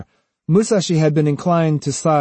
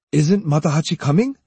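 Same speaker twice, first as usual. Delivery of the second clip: insistent, slightly raised question; mildly pushy questioning; persistent; 4 semitones higher, 3 dB louder than usual. A narrator's reading. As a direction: press for an answer. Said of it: "he persisted".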